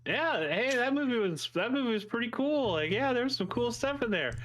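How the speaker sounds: high, breezy fake-cheery parent voice; forced-casual parent. Parent on the drive home, high and chirpy to dodge the topic. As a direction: paper over the awkwardness brightly.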